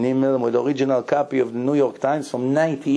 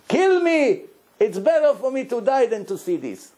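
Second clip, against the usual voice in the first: distraught cry; 7 semitones higher than usual. Retold or imitated.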